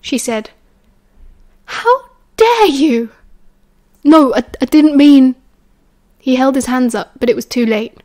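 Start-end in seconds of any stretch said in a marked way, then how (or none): 1.6-3.1 slow, high, loud outrage; outraged. A narrator's reading. Repeated outrage, voiced. 4.0-5.4 flustered. A narrator's reading. Remus flustered, stammering.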